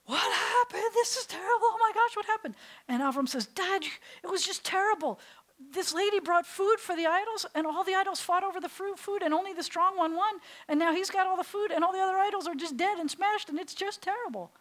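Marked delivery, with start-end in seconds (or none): 0.0-2.6 high, loud dismayed dad; hammed-up cry of dismay at the wrecked shop; a father discovering his ruined shop; shocked father. Father returns to smashed shop. 3.5-14.5 put-on high, loud excited teen storytelling; a son spinning a tall tale for his father; teen Avram. Avram gives father fake story.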